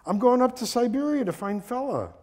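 high, loud shouted answer; calling back. Acted. Traveler answering his friend from the train, calling back. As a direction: project the reply across the platform.

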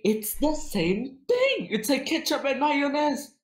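high, squeaky mock argument; mock know-it-all. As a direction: argue it in a silly high voice. Mock-serious arguing in high voice.

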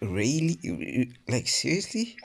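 high, disbelieving squeak; incredulous. Real, not acted. Gamer getting an unwanted character, incredulous.

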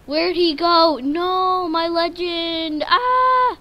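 high, loud panicked wail; loud dismayed yelp; dismayed cry. Real, not acted. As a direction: cry out as your trader vanishes. Villager trader vanishes.

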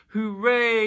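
high, loud cheer; joyful shout. A narrator's reading. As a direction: shout it out in triumph. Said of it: "you shouted".

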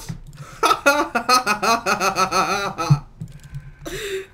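long, gleeful cackling laugh; ugly laugh. Real, not acted. Apologising afterwards for his ugly laugh, long gleeful laughter. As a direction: laugh helplessly with joy. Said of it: "meine richtig eklige Lache".

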